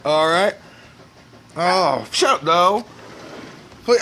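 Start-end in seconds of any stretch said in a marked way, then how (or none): none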